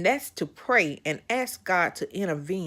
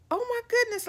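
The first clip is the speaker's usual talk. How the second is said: startled; 4 dB quieter, faster than usual. Retold or imitated. Recalling the moment she glanced up at the ceiling, startled. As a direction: exclaim it high in retold alarm.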